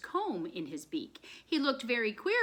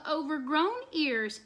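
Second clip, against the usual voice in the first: ordinary delivery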